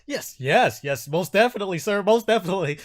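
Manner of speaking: high, loud, flustered pleading voice; eager nervous captive. Acted. Captive pleading with his captor over their deal, high and flustered. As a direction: agree frantically, then protest the deal. Game master plays captive man.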